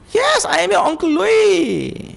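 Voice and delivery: high, bright uncle's voice; cheerful uncle. Retold or imitated. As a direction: call out warmly to the boy. Officer insists he is uncle.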